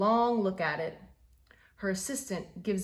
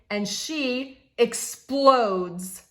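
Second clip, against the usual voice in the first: very slow, louder and higher, blowing up; a big drawn-out blow-up; explosive; 4 semitones higher, 8 dB louder, much slower than usual. A narrator's reading. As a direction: let it burst out slowly. Climax of anger.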